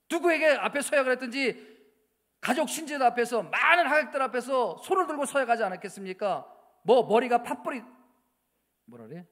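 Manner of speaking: loud, high, forceful sermon voice; full-force pulpit preaching; fiery preaching. Acted. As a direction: thunder at the congregation.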